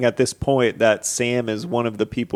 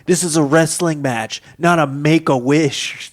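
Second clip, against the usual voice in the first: slightly raised, put-on menacing wrestler; mock menacing; 4 semitones higher than usual. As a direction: imitate a wrestler's taunt, lightly menacing. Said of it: "really good at being menacing".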